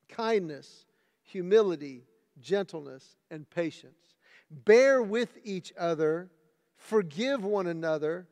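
loud, measured Bible reading; scripture reading. Acted. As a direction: proclaim each verse to the church. Reads scripture passage aloud.

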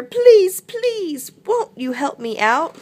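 high, pleading wail; wailing tiger. A narrator's reading. Tiger begging animals for help, high wail. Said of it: "Wailed Tiger".

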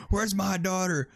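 mock angry mother. Voicing the game's mother at the player, mock furious. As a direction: blame them like a furious mom.

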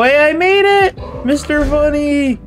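high, delighted call; excited victory shout-out; triumphant. Real, not acted. Wins red light green light round.

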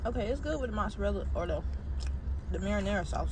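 speaking with food in mouth; slightly muffled by chewing; chewing food while talking. Real, not acted. Seen 1: Bit food at -3; talks holding the bitten piece, mouth working.

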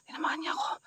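breathless whisper after being hit. Real, not acted. Vlogger whispering after the aswang hits him. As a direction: whisper it in shock.

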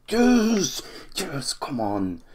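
sudden high yell of joy; joyful shout. Real, not acted. Fan cheering his team's goal, a high joyful yell. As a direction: leap out of the seat yelling. Goal scored in crucial match.

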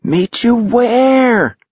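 long, high-pitched yell; high drawn-out shout. A narrator's reading. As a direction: draw out a desperate call into the dark. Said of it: "yelled into the dark".

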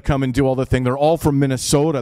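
ordinary talk

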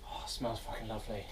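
normal talking voice

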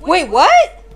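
high loud wait what; stunned by the twist; shocked. Real, not acted. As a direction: react in real disbelief. Story twist reveal.

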